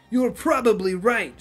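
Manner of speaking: Shang Tsung voice. Acted. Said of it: "copy the bullshit version from Mortal Kombat nine".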